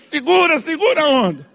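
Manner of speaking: high, urgent self-command; strained self-restraint. Acted. Father telling himself to hold back, strained. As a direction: rein yourself in frantically.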